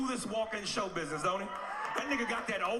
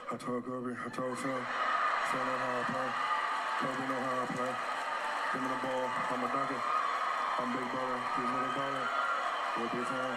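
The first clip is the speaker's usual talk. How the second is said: Shaq impression; 9 semitones lower than usual. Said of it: "slowest talking motherfucker in the league".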